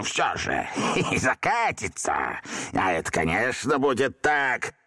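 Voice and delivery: медленно, торжествующе, со смешком; торжествовать над мальчиком; triumphant drawl. A narrator's reading. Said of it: "торжествующе протянул". (торжествующе)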